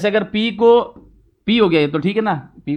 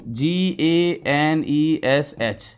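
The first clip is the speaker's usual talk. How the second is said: जी ए एन ई एस एच spelled letter by letter, very slow; spelling out; 3 semitones lower, 4 dB quieter, much slower than usual. Shown as a demonstration. Teacher spelling Ganesh letter by letter. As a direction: spell it out slowly, each letter apart. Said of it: "spelling क्या होती है इसकी?".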